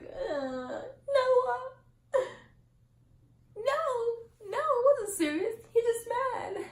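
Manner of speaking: high, loud, hammed-up melodrama; mock movie heroine. Vlogger parodying The Notebook about her romance, mock movie heroine. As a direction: swoon and wail like a romance film. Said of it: "the fucking Notebook".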